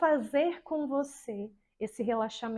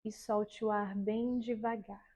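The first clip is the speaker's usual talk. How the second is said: slow, soft, soothing relaxation voice; guided meditation; 3 semitones lower, 3 dB quieter, slower than usual. Shown as a demonstration. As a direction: lull the class into calm, very slowly. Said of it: "E aí você vai começar assim, ó".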